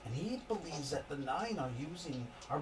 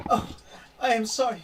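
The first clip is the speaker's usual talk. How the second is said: higher, welling-up tearful apology; tearful wife; 7 semitones higher, 10 dB louder than usual. Acted. Wife near tears leaving the guest room. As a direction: hold back tears as she excuses herself. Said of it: "she goes".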